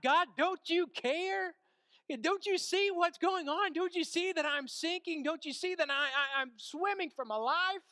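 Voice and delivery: high, loud, desperate pleading; calling out. Retold or imitated. Sinking believer calls out to God, frantic shouted plea. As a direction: plead at full voice, as if drowning. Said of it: "And we call out to God".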